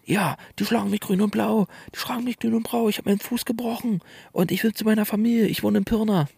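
put-on confused old woman. Confused woman pleads with narrator.

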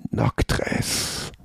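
a wary, breathy whisper; cautious whisper. A narrator's reading. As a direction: whisper the name warily. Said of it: "sagte er vorsichtig".

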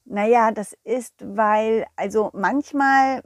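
ordinary delivery

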